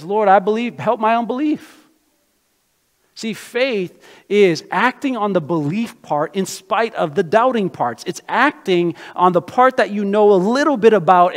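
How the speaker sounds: loud, rising preacher's call; preaching loud and high at full force. Acted. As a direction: drive it home to the congregation.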